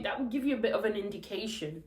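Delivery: ordinary talk, nothing marked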